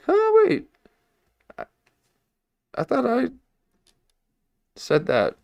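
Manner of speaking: high squeaky double take; mock surprise. Host recalling hearing his points repeated, in high mock surprise. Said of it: "I'm like".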